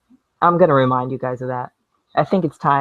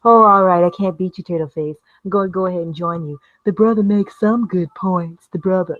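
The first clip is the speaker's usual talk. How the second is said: higher, put-on conceding voice; mock caving in; 4 semitones higher than usual. Vlogger imitating someone who caves and joins him, mock conceding. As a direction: give in as a mocking imitation. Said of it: "get you to cave and go".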